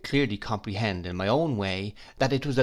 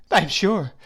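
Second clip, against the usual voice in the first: tearful; 8 semitones higher, 6 dB louder than usual. Acted. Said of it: "with many tears".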